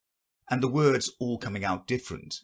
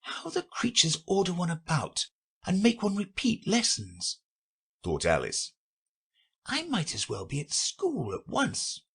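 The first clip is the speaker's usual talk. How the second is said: put-on high, grumbling girl; a put-upon schoolgirl; Alice's voice; 10 semitones higher than usual. A narrator's reading. Alice grumbles to herself about lessons, girlish voice.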